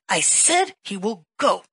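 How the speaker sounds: loud, slow, forceful rebuke; Jesus insisting firmly; said it strong. Retold or imitated. Jesus rebuking the preacher, said strong and loud. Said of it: "Jesus got really mad and he said it strong".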